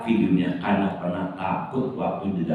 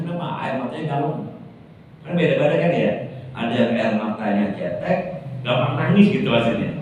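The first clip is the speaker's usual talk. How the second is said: ordinary talk, nothing marked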